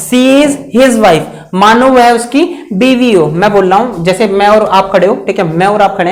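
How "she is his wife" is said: slow dictation. Shown as a demonstration. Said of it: "teacher dictates the example slowly".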